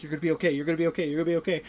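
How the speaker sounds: rapid retold reassurance; frantic reassurance. Peter telling dying Aunt May, rapid frantic reassurance. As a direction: repeat it quickly, words tumbling out. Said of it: "like, freaking out".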